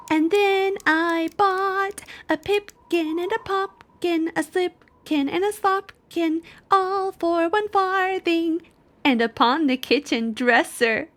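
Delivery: high mocking mouse voices; rude mice. A narrator's reading. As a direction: tease like cheeky mice. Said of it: "added the rude little mice".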